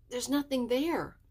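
forcing the words past a tight throat; choking voice. A narrator's reading. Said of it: "in a choking voice".

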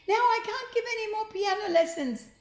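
high, loud whiny voice; Sloth's wail. Acted. Sloth whining from the wreck of her piano. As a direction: whine it like a spoilt grande dame.

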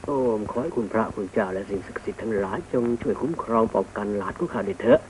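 put-on soft, low incantation over the boy; hushed protective chant; murmured blessing prayer. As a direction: pray quietly for his protection. Hermit blesses grandson with incantation.

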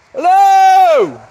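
calling out a high, loud hello. Real, not acted. Man in his woodland shouting hello to any intruders, loud call. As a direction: shout hello to whoever might be in the woods.

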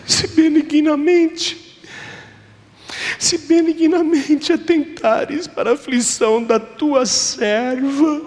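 high, slow, weeping plea; tearful prayer. Retold or imitated. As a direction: beg through tears, drawing out benignamente. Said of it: "Chorando ela falava".

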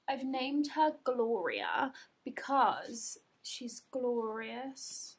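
slow unvoiced whisper; doting whisper. Real, not acted. Naming her new bag, slow doting whisper. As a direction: whisper it lovingly.

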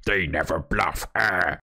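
slow, drawn-out mocking quote; mock dogmatic claim. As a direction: mimic someone making a silly sweeping claim. Mimicking a simplistic poker claim.

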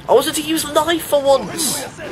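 high-pitched frustrated complaint; frustrated whine. Real, not acted. Player complaining about a thwarted knife plan, frustrated.

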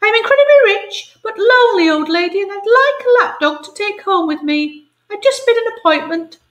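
very high, fast fussy falsetto; grand rich lady. Acted. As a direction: gush like a lonely rich dowager. Same grand lady continues.

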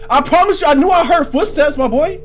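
high, loud insistent cry; alarmed shouting. Real, not acted. Alarmed by footsteps in game.

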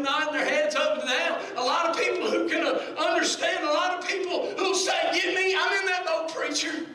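full-force preaching. Real, not acted. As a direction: call out to the congregation.

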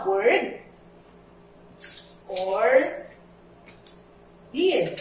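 "word", "or", "deed" each said alone with long pauses; words spaced apart. Shown as a demonstration. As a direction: give each word its own beat for the class. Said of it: "tandaan nyo ha".

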